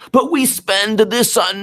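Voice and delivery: high, slow whiny objection; high mocking voice. Mocking an opponent's argument.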